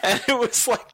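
ordinary talk

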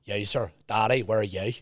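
imitated Northern Irish speaker; Northern Irish accent. Shown as a demonstration. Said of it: "That's Northern Irish".

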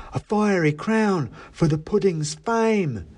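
high, drawn-out rhyming mouse voice; solemn little mouse reciting a rhyme; mouse elf. A narrator's reading. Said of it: "he continued".

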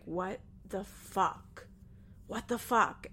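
slow, hushed first, then firmer; stunned disbelief. Retold or imitated. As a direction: act out a dumbfounded aside, word by word. Retold stunned aside to friend.

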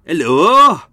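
loud, high Welsh hello; Welsh accent. Shown as a demonstration. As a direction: demonstrate an accidental slip into Welsh, loudly. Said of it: "keeps going into bloody Welsh".

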